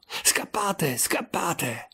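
high, hushed cry to flee; high-pitched, held-back alarm; urgent warning. A narrator's reading. Acted urging to flee.

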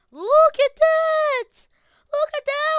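high, loud, slow amazed cry; overwhelmed by the loot; excited shriek. Real, not acted. Loot pile appears.

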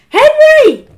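full high-pitched bellow; bawled name. A narrator's reading. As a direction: bawl your son's name in fury. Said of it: "bowled Mum".

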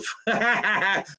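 high laugh breaking into the sentence; amused laugh. Real, not acted. Comedian reading a viewer's jab at the accent, bursting out laughing. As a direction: crack up mid-sentence.

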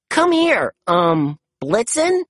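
high, hesitant call ending in a question; tentative, high-pitched summons; unsure calling. A narrator's reading. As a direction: coax it over, guessing at the name. Chase guesses the reindeer's name.

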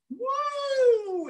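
high gliding whoop; long high "woo" swooping down; playful "woo" whoop. Acted. Host flying French fry man in circles, high playful whoop.

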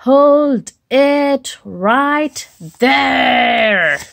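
slow booming stop; stern command. Acted. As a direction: halt them like a guard. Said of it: "this guy says".

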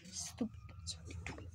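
hushed, grumbling curse at the die; whispered curse. Acted. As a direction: mutter it under your breath.

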